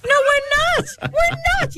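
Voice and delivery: high, slow, insistent denial; high protest. Real, not acted. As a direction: squeak out a mock-indignant no. Protesting a listener's text.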